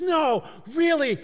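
high, loud cry of disbelief; stunned disbelief. Retold or imitated. Angel hearing of her promotion, shouting in disbelief. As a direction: pitch it up as if you can't believe it. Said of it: "She goes".

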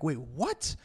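high-pitched, baffled protest; mock disbelief. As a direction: act out your own stunned double take. Acts out disbelief at the photo.

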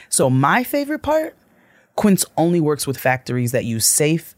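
measured, polished sponsor read; podcast ad read. Acted. Host pitching affordable luxury to listeners, polished ad read. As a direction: sell it smoothly with lifts on key phrases.

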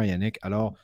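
normal talking voice

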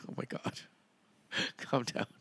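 breathy exasperated whisper. Retold or imitated. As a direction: hush it out, half laughing. Exasperated aside at dramatic actor.

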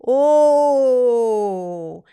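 loud drawn-out mock surprise; a big, stretched oh of surprise; surprised oh. Reacting to wordier phrasing. (sorpresa exagerada y alargada)

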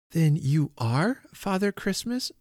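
put-on high, slow child's question; eager child. A narrator's reading. Little girl checking on Father Christmas, high and wondering. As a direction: ask it slowly, voice rising high.

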